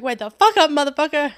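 high, very loud, laughing-angry shout; furious yell. Retold or imitated. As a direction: scream him awake in a rage. Throwing water, yelling at boyfriend.

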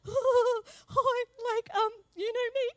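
high, loud, giddy nervous hello; nervous excitement. Retold or imitated. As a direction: rush in jittery and bright. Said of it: "I'm just like".

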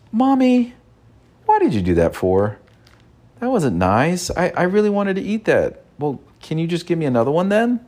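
put-on high, pleading little-boy voice; child voice demo. Shown as a demonstration. Father demonstrating to son how to ask Mommy, in a high childlike voice. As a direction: play the kid asking nicely. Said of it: "And I said".

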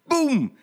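high, loud boom; crash sound. A narrator's reading. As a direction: bang it out. Tram hits door.

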